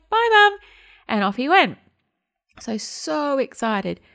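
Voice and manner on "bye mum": put-on child's goodbye. Son voiced calling goodbye.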